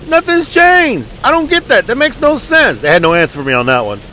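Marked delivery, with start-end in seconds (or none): 0.1-1.1 much higher and very slow, disbelieving; exasperated repeat. Retold or imitated. 1.2-2.8 much higher, disbelieving complaint; incredulous exasperation. Retold or imitated. Mocking exasperation at creditors.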